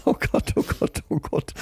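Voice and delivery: breathless, near silent laughter; wheezing with suppressed laughter; stifled giggles. Real, not acted. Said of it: "zum Lachen bringen".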